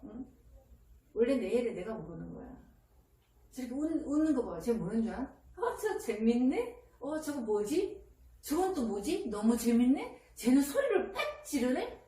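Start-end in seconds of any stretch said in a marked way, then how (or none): 5.6-12.0 put-on slow, lilting wondering child; voicing amused child's thoughts. Consultant voicing what the laughing child thinks, slow playful wonder. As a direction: muse slowly, stretching each curious question. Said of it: "이런다, 나한테".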